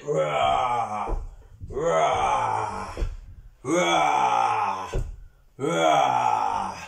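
playful growling dinosaur roars; T-Rex roar. Pretend. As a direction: roar along with the class as a T-Rex. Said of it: "making the noise of the T-Rex".

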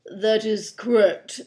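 slow, precise, formal voice; proper rascal. Acted. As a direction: answer stiffly, nothing like a pirate. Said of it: "said the rascal".

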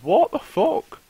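high, loud incredulous shout; high loud outraged disbelief at the loot; shocked outburst. Real, not acted. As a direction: squeal the disbelief at the game.

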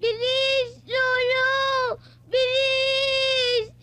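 tearful pleas to grandfather; frightened child in tears; crying. Acted. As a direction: wail for help, stretching the words. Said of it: "huwag kayong umiiyak".

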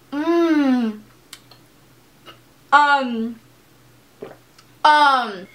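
savoring a taste; sipping a drink between words. Real, not acted. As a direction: mouth wet from drinking. Seen 4: Bottle at lips at 2.7-3.6 and 5.6; tasting between sips.

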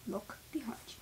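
hushed whisper. Acted. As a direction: whisper a warning. Warning of something behind.

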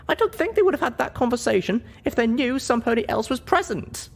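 put-on a high young pony heroine choosing her words; heroine's voice. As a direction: speak up as the small, earnest heroine. Heroine explains the memory.

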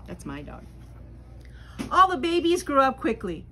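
usual speaking voice

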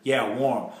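usual speaking voice

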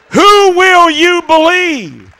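usual speaking voice

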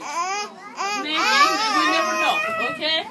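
tearful voice breaking; crying through the words. Real, not acted. Teacher tearfully saying goodbye.

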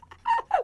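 high, loud mock cackle; put-on burst of laughter; demonstrative laugh. Shown as a demonstration. Teacher acting out watching a comedy, put-on laugh.

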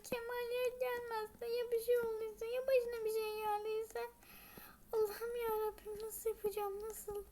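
high wailing prayer, half sung; panicked lament. Acted. Husband won't answer, wailing prayer in panic. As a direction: cry out to God, near tears.

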